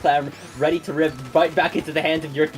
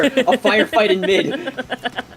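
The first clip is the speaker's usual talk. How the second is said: laughing; 7 semitones higher, 3 dB louder, much slower than usual. Real, not acted. Laughs while calling the fight.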